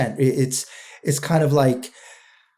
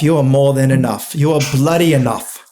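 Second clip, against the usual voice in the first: louder, hammed-up English accent with mate; 6 dB louder than usual. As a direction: play the cheerful English bloke. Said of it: "Can you do it in an English accent?".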